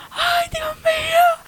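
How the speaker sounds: very high, slow mock wail; retold crying. Mother crying as she leaves the doctor, re-enacted high wail. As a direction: draw it out high, imitating a tearful lament. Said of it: "yo llorando".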